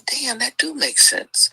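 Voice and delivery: high, slow whispered amazement; amazed whisper. Retold or imitated. Vlogger retelling her own reaction, in a high slow whisper. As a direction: breathe it out in disbelief. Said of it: "I said".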